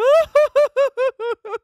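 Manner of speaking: sudden high, loud laugh; overwhelmed bursting laugh; burst of laughter. Real, not acted. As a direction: laugh out in disbelief. Overcome reading a tagged post.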